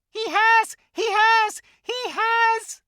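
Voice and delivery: very high, loud, frantic squeak. Acted. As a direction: squeal it out like a tiny squirrel. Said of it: "it squeaked".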